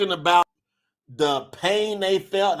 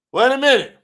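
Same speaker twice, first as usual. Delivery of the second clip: higher, louder startled halt; sudden stop; 6 semitones higher, 7 dB louder, faster than usual. Real, not acted. Preacher interrupts his chat reading, startled and loud. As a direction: catch yourself abruptly.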